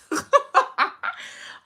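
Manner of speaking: high breathless laugh through tears; laughing it off to keep from crying; teary laugh. Real, not acted. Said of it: "You can't cry".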